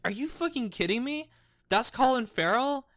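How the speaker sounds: imitating a friend who can't believe it; friend's disbelief. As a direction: play the stunned friend in retelling. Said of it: "And he's like".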